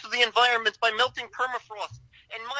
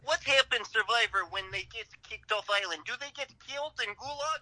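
heavy mock Russian accent. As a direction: prank caller in Russian accent. Prank caller accent.

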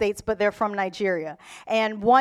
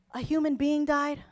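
mock concern; 2 semitones higher, 7 dB quieter than usual. Mimics someone indifferent to human death.

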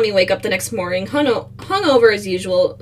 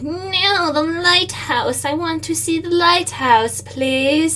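high, drawn-out mock plea; mock pleading; 7 semitones higher, slower than usual. Co-host as Tommy begging for the lighthouse, mock pleading. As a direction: beg in a put-on high voice.

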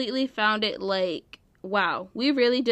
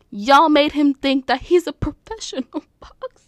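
loud start breaking into laughter; incredulous, dissolving into laughter; cracking up; 3 semitones higher, 5 dB louder than usual. Real, not acted. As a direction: lose it laughing by the last word. Cracks up while scolding fans.

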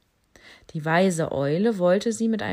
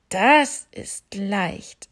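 very slow, louder odd bat voice; 2 semitones higher, 7 dB louder, much slower than usual. Acted. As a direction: bat confident and pleased. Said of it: "sagte die Fledermaus". (sehr langsam, selbstsicher)

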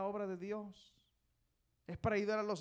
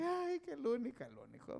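mock lovesick wail; 8 semitones higher, 3 dB quieter than usual. Preacher mocks someone crying over a boyfriend, mock wail. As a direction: imitate someone crying over a sweetheart, starting high. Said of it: "Llorando por ese".

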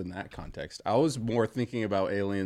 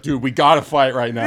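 put-on drunk buddy voice; 4 semitones higher, 11 dB louder than usual. Imitating drunk friend's challenge.

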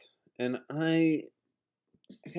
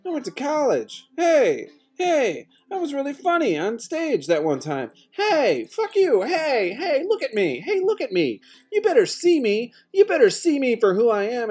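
rising plea; 9 semitones higher than usual. Real, not acted. Lonely speaker demanding the world notice him, rising to a high plea. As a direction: demand to be seen, building and building. Building self-pity rant.

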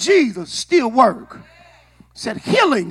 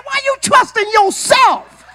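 ordinary talk

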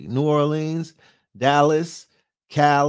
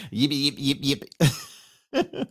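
Pee Wee Herman impression; slower than usual. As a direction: chirp it like a manic man-child. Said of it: "Or Pee Wee Herman".

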